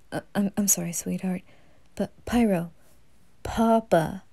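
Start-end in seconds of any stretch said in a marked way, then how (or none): none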